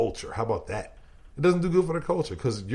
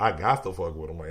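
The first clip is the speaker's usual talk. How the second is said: ordinary delivery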